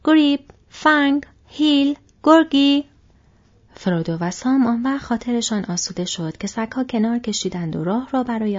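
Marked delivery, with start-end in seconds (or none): 0.0-2.8 loud, slow, higher call to the dogs; shouted call. A narrator's reading. Farmer calls his dogs off the travellers with a loud shout. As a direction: bellow the dogs' names across the yard. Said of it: "فریاد زد".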